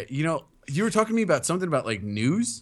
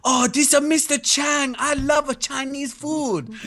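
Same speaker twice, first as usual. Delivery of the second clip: high-pitched, drawn-out cartoon Chinese accent; hammed-up Mister Chang with a broken Chinese accent; mock Chinese accent; 12 semitones higher, slower than usual. Demonstrating a skit character to co-host, mock Chinese accent. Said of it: "you do random voices".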